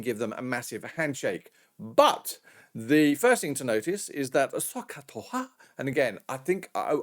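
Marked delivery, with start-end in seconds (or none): 4.5-5.5 badly imitated Japanese accent on SOHCAHTOA; mock Japanese accent. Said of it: "when I'm ruining your accent".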